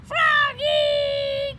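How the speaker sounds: loud, high call across the field. Acted. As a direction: call him from far away. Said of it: "called his teacher".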